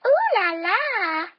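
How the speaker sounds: slow, sultry ooh la la; slow playful mermaid coo; flirty mermaid. Acted. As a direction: draw it out like a glamorous mermaid. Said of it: "and she says".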